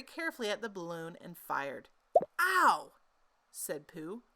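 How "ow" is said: high, loud ow of surprise; sharp yelp of pain. Acted. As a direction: yelp as the shot hits. Balloon shot, Pooh yelps.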